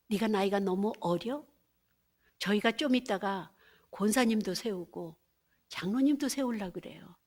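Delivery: normal talking voice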